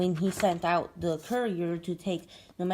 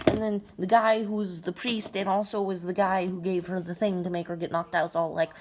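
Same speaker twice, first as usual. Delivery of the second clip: ordinary talk, nothing marked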